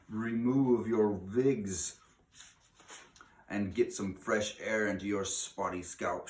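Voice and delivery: very slow, loud, strange witch voice, wigs as vigs, R rolled; witch's accented shout. A narrator's reading. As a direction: roll the R and spit out the order. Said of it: "she shouted".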